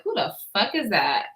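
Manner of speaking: recalling the fine man, quietly awed. Retold or imitated. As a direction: say it under your breath, smitten. Retells it again, dreamy.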